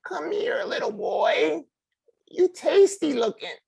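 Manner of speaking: high, slow witch cackle voice; scary witch voice. Acted. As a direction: croon like a hungry witch. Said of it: "I put on a scary witch voice".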